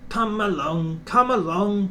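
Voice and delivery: puffed. A narrator's reading. James starting the express, lightly puffing. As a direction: puff it out eagerly. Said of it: "puffed James".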